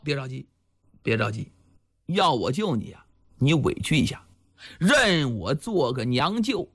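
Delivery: low voice of a steady village chief; Chao Gai, reassuring. Acted. Village chief telling the captive not to panic, low and reassuring.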